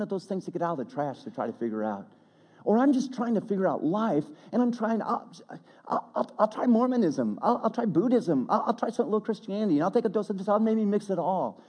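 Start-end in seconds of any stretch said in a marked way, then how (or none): 2.6-11.6 high-pitched, imitated eager seeker; searching and trying everything. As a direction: rattle off options in a raised, searching voice. Voicing a searcher trying every religion.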